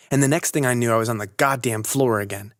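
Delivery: usual speaking voice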